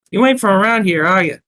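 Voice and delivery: put-on line in a high Brooklyn accent; Brooklyn barmaid's accent. Narrator mistakenly voicing his line as the barmaid, Brooklyn accent. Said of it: "that's the wrong voice".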